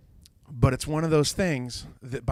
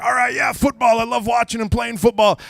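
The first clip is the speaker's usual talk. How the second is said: loud, high, pumped-up shout; hyped-up football fan; 11 semitones higher, 7 dB louder than usual. Retold or imitated. New coach of nine-year-olds' team, hammed-up excitement. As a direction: holler it like an overexcited new coach.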